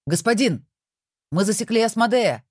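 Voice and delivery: high excited yell; shouting demon. A narrator's reading. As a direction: burst in shouting the news. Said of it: "заорал появившийся ... демон".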